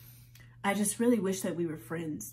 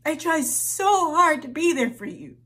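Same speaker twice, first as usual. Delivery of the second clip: high, loud and breaking into tears; tearful shouting; 8 semitones higher, 6 dB louder than usual. Acted. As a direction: scream it through sobs. Screaming and crying at mother.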